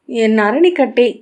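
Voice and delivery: put-on high, loud child's wail; crying wail. A narrator's reading. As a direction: wail the question out like a bawling child. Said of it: "அழுதபடி".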